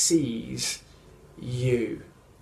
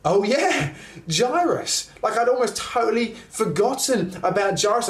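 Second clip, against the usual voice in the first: sudden recollection; 6 semitones higher than usual. Retold or imitated. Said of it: "we go".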